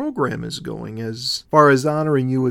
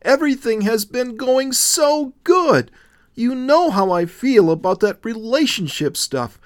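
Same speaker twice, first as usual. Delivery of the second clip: higher and louder, anxious outburst; nervous exclaiming; 6 semitones higher, 4 dB louder than usual. A narrator's reading. Willow protesting to Jesus, nervous and high-pitched. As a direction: pour it out in a rising nervous panic. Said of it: "she exclaimed nervously".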